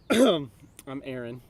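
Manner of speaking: strained after coughing; hoarse. Real, not acted. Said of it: "Aaron's dying a little bit".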